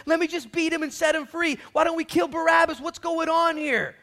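high, loud, frantic questioning; loud acted bewilderment; exasperated Pilate. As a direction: shout over a riot. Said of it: "He sees the riot happening".

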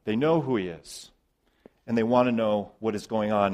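normal talking voice

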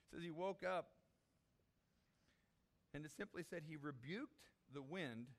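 usual speaking voice

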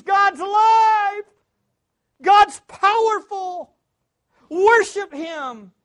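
loud, high, slow shouted praise; voicing creation's scream. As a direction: let creation cry out, stretched and loud.

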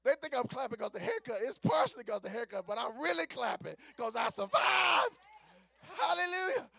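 loud, high celebratory preaching; jubilant relief. Acted. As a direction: build to a triumphant shout.

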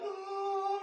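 put-on high, wide-eyed childish oh; awed kid's gasp. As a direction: let it jump up high like a kid seeing treasure. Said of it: "that was like".

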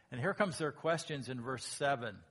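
ordinary talk, nothing marked

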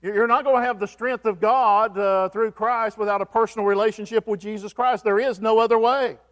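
full-force sermon climax; fervent preaching. Acted. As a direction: press the point home to the congregation.

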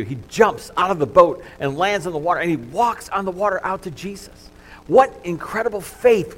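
high, loud preaching voice, building; full-force preaching. Acted. Pastor to congregation, retelling Peter on the water, loud and high. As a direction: preach it out with rising fervor.

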